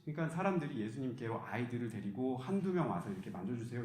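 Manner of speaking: usual speaking voice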